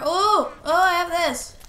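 high, loud burst of excitement; excited discovery. Real, not acted. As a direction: exclaim it as a delighted find. Spots a wanted item.

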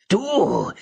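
high piercing yell; shouting. A narrator's reading. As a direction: call her out across the hall. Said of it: "schrie er wieder".

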